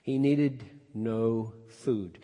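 normal talking voice

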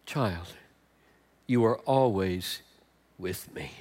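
soft, gentle, slow; tender reassurance. Shown as a demonstration. Father softly telling his older son he is always near, tender. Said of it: "Isn't that the tone?".

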